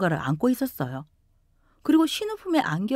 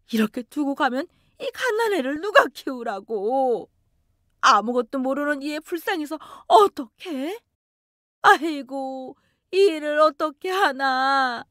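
high tearful wail of despair; a mother's crying over the abandoned baby; sobbing lament; 5 semitones higher than usual. Acted. Mother sobbing to daughter-in-law over the abandoned baby. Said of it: "바닥을 치며 흐느끼셨어요".

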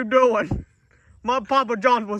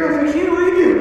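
desperate plea; 7 semitones higher, 7 dB louder than usual. Acted. Refusing to abandon a dying friend.

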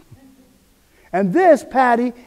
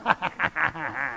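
low mocking chuckle; devil's low laugh; 6 semitones lower, 12 dB quieter than usual. Preacher imitating the devil laughing at a doubter, low mocking chuckle. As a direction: laugh softly like the gloating devil. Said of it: "And he's laughing".

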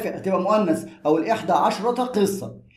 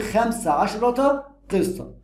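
slow model phrase; 2 semitones higher, much slower than usual. Shown as a demonstration. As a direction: draw out خمس عشرة قصة. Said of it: "teacher models the full phrase".

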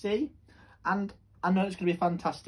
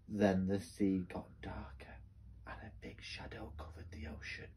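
whispered, hushed storytelling; suspense whisper; 10 semitones lower, 10 dB quieter than usual. A narrator's reading. Suspense as shadow falls.

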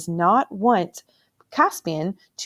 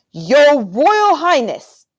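slow, loud, higher icy address; cold fury; 9 semitones higher, 8 dB louder, much slower than usual. A narrator's reading. King coldly confronting his nephew, icy anger. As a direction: bite off each word of the title.

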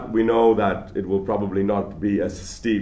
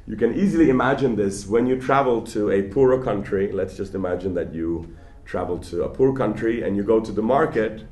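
usual speaking voice

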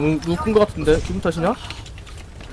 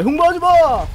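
shouting; 10 semitones higher, 5 dB louder, slower than usual. Real, not acted. Urging teammate.

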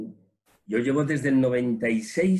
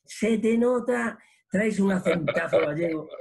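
amused remark; 7 semitones higher than usual. Retold or imitated. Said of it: "Y yo, "Sí, ¿por qué?".

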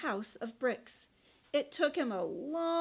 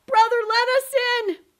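high, loud, fast plea; panicked pig; 10 semitones higher, 12 dB louder, faster than usual. Acted. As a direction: beg in a rush. Pigs flee.

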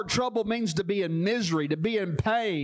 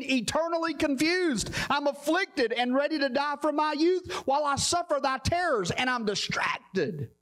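impassioned preaching; 7 semitones higher than usual. Real, not acted. Preacher warning the congregation about hell, impassioned preaching. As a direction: preach it urgently, driving through each phrase.

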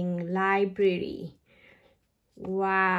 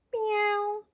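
much higher mock meow; cat meow; 9 semitones higher than usual. Reading to children, a high cat meow. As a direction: mew like a little cat. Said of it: "Animal sounds".

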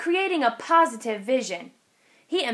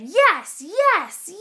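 loud, rising cheer of yes; shouted yes; 6 semitones higher, 5 dB louder than usual. A narrator's reading. Kids answering the driver, loud excited shouts.

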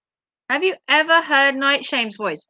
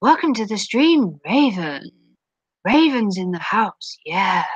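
a lightly done lower streamer greeting; Night Shame impression; 4 semitones lower than usual. Streamer imitating another streamer's stream greeting, lightly lower. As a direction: imitate his smooth welcome, slightly lower. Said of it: "I'm trying to think how he sounds".

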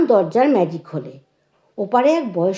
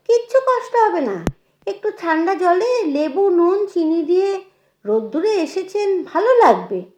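high, animated coaxing; eager insistence; 8 semitones higher than usual. A narrator's reading. Hostess pressing a cool drink on her old teacher, eager. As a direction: brush off the objection brightly, pitch well up.

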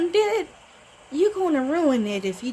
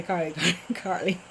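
laughing; 6 semitones lower, 4 dB quieter than usual. Real, not acted. Laughing while repeating name.

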